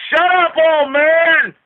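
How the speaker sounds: slow, high, loud jeering taunt; a teasing rocker shouting through the door; mocking taunt. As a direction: stretch it out to mock him. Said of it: "Watch this".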